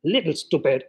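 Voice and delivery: low, soft contemptuous jab; muttered scorn. Acted. Woman snapping at Grisha over the orange, low and soft. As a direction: dismiss him under your breath. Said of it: "slapping his hand".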